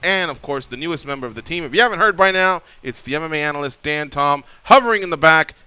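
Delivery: loud, high hype show intro; radio announcer. Acted. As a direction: pump up the crowd like a radio host. Host opens the show.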